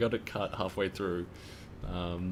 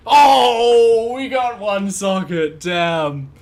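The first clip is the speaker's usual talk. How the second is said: high, loud cry of suspense; excited shout; 9 semitones higher, 13 dB louder, much slower than usual. Real, not acted. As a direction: yell as the roll lands. Checking the item roll.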